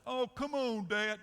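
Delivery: high, loud mock-daughter protest; pleading protest. Daughter objecting to Dad's girl-thing verdict, pleading protest.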